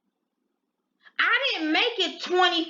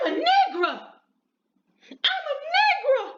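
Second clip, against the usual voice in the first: desperate pleading; 14 semitones higher than usual. Retold or imitated. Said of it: "she's like".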